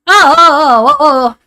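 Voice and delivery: real startled burst of oh's; loud, rattled yelps of surprise; jump scared. Real, not acted. Streamer jump scared mid-stream, startled. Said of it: "I got jump scared by that".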